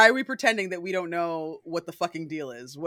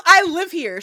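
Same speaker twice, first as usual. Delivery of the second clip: loud, high outraged protest; indignant yell; 11 semitones higher, 12 dB louder than usual. Retold or imitated. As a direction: stand your ground, shouting. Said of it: "She goes".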